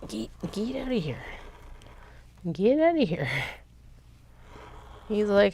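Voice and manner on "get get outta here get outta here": shooing. Real, not acted. Shooing the cat off her work.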